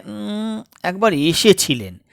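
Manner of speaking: ordinary delivery, nothing marked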